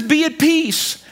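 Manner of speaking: slow, calm, gentle authority; calm command. Retold or imitated. Jesus speaking peace to the storm, slow and calm. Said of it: "But he says".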